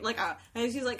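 ordinary talk

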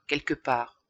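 ordinary delivery

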